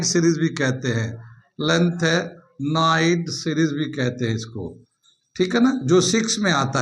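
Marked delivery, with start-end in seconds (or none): 1.7-4.8 lanthanide drawn out syllable by syllable; slow dictation. Real, not acted. Teacher repeating a series name for students, slow dictation. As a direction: repeat the term slowly for note-takers.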